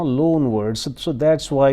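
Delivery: ordinary delivery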